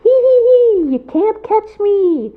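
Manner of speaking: put-on very high giggling child voice; teasing little boy. A narrator's reading. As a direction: taunt him like a cheeky kid. Beignet boy taunts, giggling.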